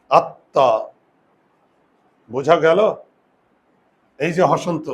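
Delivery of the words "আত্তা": আত্মা said crisply as "আত্তা" with a double ত; doubled consonant. Shown as a demonstration. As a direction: repeat আত্মা as আত্তা, louder. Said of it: "তাহলে দেখো উচ্চারণটা কি হয়". (আত্মা — দ্বিত্ব ত স্পষ্ট করে)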